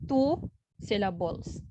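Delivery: usual speaking voice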